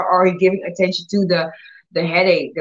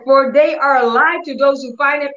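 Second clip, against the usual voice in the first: verse declared with raised pitch, imitating her anger; angry; 6 semitones higher, 3 dB louder than usual. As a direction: recite it heatedly, higher than usual. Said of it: "really got angry".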